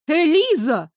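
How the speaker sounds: high-pitched outraged exclamation; indignant. A narrator's reading. Daughter rebukes Elisa for agreeing, indignant. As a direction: exclaim the name in protest. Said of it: "возмутилась я".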